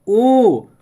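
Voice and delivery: ओ sung out loud with rounded lips; rounded o demo. Shown as a demonstration. Teacher to students, shows ओ loudly from the lips. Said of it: "ओठ, ओठ को जो देखिए".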